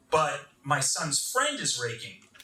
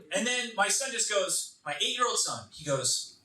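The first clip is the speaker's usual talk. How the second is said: usual speaking voice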